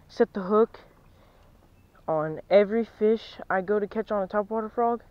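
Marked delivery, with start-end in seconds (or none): none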